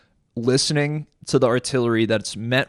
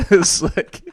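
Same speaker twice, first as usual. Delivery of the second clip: laughing; 7 semitones higher, 6 dB louder than usual. Real, not acted. Still laughing.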